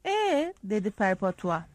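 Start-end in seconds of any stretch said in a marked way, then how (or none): 0.1-0.5 high, leading "Ee?"; a grin in a rising-pitched query; smirking prompt. A narrator's reading. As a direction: prod them with a high, knowing question. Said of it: "bakıp sırıtarak".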